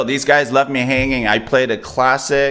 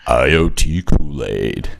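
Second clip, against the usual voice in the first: deep slow voice; 9 semitones lower, much slower than usual. Acted. Deep radio voice joke.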